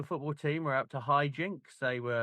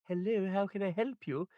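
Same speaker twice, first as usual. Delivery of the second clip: mock posh English phone voice; 6 semitones higher than usual. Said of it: "my mum answering the phone".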